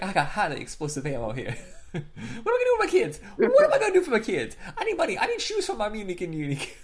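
high, fast, hammed-up quote breaking into laughs; laughing hammed-up impression. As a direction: squeal it out like a giddy kid through laughter. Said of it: "He's like".

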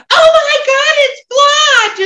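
high, loud mock-celebrity-sighting shout; excited yell. Whoopi yelling for Sally Fields in the middle of the mall, excited yell. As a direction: shriek it out like spotting a star in public. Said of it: "gets Whoopi to yell".

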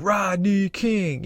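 slow, high drawn-out chant; retold rallying cry. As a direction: stretch the name out high for a crowd. Retold leader riling up the crowd.

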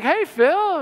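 high sing-out greeting, imitated; imitated neighbour greeting. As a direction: call out a high-pitched hello as the neighbour. Neighbour's greeting, voiced by teller.

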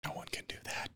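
whispered aside. Real, not acted. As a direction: whisper it in awe.

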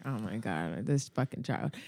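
low, soft, weary grumble; imitating exasperated mom. Mom fed up with her spinning child, exasperated low grumble. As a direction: grumble it low, under your voice.

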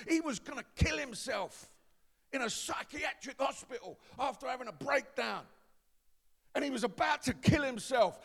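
loud, climbing preacher's delivery; full-force preaching. Acted. Preacher telling the congregation the friend's testimony, full-force preaching.

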